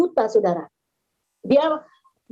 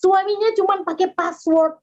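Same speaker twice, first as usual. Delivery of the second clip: ordinary delivery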